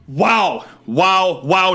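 ordinary talk

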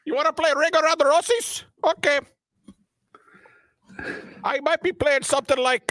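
Gil. Retold or imitated. Gil suggesting songs to play, in a high character voice. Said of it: "that's Gil over there".

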